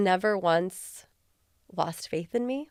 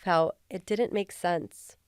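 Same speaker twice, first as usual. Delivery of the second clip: normal talking voice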